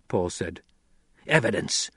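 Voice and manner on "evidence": plain reading voice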